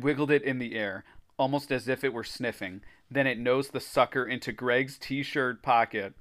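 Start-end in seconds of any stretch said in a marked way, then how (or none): none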